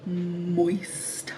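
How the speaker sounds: breathy hushed Moist; whispered word. Shown as a demonstration. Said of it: "Don't y'all love that word?".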